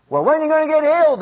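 high, loud, quick challenge; taunting devil. Acted. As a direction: needle them as the devil, pitched well up. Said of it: "the devil says".

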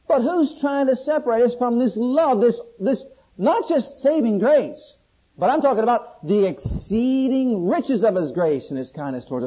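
high, loud, driving sermon voice; full-force preaching. Acted. Preacher asking congregation who can separate us, full-force preaching. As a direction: fire out each challenge and its emphatic answer.

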